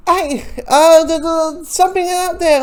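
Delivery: high, loud, urgent heads-up; alarmed warning. Retold or imitated. Hit Girl warning the men about the danger outside, urgent high call. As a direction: call out that something's lurking. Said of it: "Hit Girl saying".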